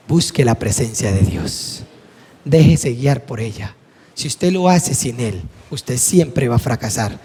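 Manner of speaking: solemn fatherly advice. Retold or imitated. Pastor advising his teenage daughter, slow and solemn. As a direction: lay it down gently and gravely.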